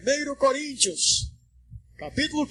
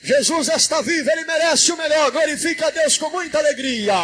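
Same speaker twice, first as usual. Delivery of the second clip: raised, driving preacher's exhortation; rousing praise shout; 5 semitones higher, 5 dB louder than usual. Real, not acted. Pastor urging applause for Jesus, rousing raised call. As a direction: whip the crowd into joyful praise.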